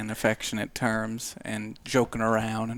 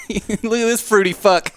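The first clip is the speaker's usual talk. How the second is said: high, loud laughing jab; 8 semitones higher, 5 dB louder than usual. Real, not acted. GM laughing at the player who kissed her hand. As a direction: tease him while cracking up.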